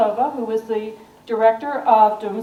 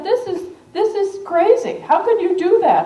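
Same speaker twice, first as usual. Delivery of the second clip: high, mock-astonished protest; imitating a disbelieving publisher, much higher; incredulous; 7 semitones higher than usual. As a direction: play the doubting publisher, pitched up. Publishers doubt Russia shipment.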